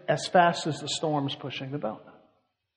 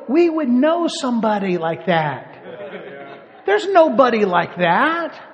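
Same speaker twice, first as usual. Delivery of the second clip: locals insisting; 5 semitones higher, 5 dB louder, slower than usual. Retold or imitated. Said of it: "They go".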